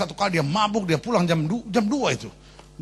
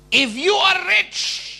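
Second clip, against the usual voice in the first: drawn out, higher, louder; preacher switches to English, slow and grand; slow preacher's English; 13 semitones higher, 5 dB louder, much slower than usual. Acted. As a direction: stretch each word like a pulpit flourish. Slow English mock sermon line.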